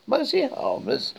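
shouted disbelief. Acted. Arthur shouts at Ford over the revised entry, loud. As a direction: shriek it back in disbelief. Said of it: "shouted Arthur".

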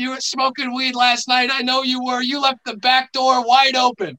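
high, loud Boston mom scolding; Boston accent. Retold or imitated. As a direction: yell it like an angry Boston mom. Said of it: "I love your Boston accent".

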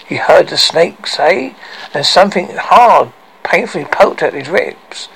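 plain reading voice

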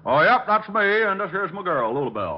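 eager country bumpkin; hammed-up backwoods welcome; hillbilly put-on. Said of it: "are you Mister Appleknocker".

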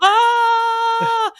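long, high-pitched scream; sustained shriek of comic terror; mock scream. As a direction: scream as if hurtling downhill. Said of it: "I was screaming like".

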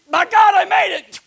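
high, very loud elated yell; triumphant shout. Acted. Believer reaching the golden street, triumphant shout. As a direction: shout it out in relief. Acted arrival in heaven.

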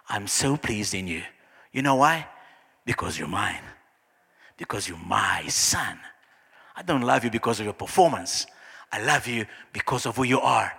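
hushed, measured affirmation; tender Father. Acted. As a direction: speak God's love down gently to a son. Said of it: "But Father says".

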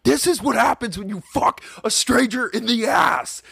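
high-pitched furious yelling; angry yell. Retold or imitated. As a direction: scream it at the kid. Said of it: "he's yelling at the kid".

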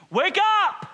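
desperate, high-pitched scream for help; panicked full-force shouting. Acted. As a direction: scream it loud like you are about to drown. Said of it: "They are screaming".

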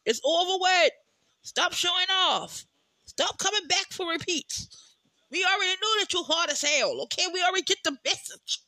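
exasperated rant at the weather; mock scolding summer. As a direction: scold the season like a guest who overstayed.

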